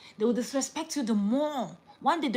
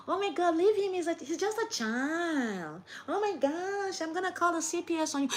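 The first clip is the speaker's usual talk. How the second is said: much higher, mocking, outraged; hysterical bystander; 9 semitones higher than usual. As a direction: ham up a shrill meddler threatening CPS. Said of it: "But people".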